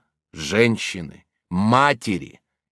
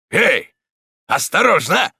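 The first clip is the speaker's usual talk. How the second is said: higher, louder taunting call; cruel mocking shout; 7 semitones higher, 5 dB louder, slower than usual. A narrator's reading. Stonecutter taunting the blinded man, cruel mock call. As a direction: jeer at the blinded victim like a game. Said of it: "крикнул, жестоко намекая на известную всем игру в жмурки".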